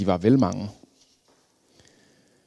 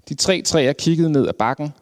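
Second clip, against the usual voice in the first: usual speaking voice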